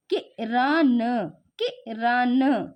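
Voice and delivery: Kiran read very slowly, syllable by syllable; slow teaching read; slow sounding out. Shown as a demonstration. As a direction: stretch Kiran out like a teacher to a child. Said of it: "हमने क्या पढ़ा?".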